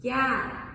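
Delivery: "Yeah" level, falling; plain falling "Yeah"; mid yeah. Shown as a demonstration. Teacher comparing tones: level "Yeah". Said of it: "It has that same thing".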